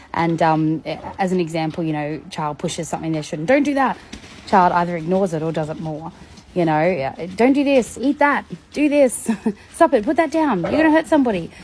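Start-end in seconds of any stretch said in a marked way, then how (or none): 3.5-4.0 higher, louder sharp parental no; parent scolding. Retold or imitated. Parent telling a child to stop, sharp and raised. 7.4-11.5 higher, louder stream of orders; nagging parent. Retold or imitated. Parent nagging a child nonstop, higher and raised.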